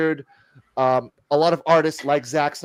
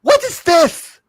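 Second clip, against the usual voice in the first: mock rude kid; 13 semitones higher, 8 dB louder, slower than usual. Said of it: "the kid pretty much".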